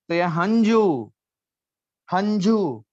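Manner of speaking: teacher modelling हंजू slowly; careful Punjabi word. Shown as a demonstration. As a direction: stretch हंजू for students to repeat. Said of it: "हंजू means आंसू".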